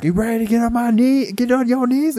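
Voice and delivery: high, loud mock holler; hyped-up con man. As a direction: imitate a hustler working up his mark. Retold con man, acted again.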